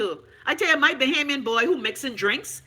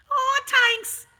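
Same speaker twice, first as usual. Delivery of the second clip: mock ecstatic thanks; 12 semitones higher than usual. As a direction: squeal the thanks like a fake overjoyed believer.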